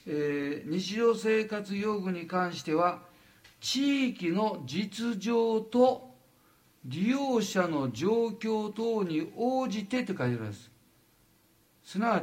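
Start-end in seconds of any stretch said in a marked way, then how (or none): 0.7-10.0 slow, measured reading aloud; deliberate, drawn-out recitation of a written clause. Real, not acted. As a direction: read the wording out slowly, word by word. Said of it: "読むとこう書いてる".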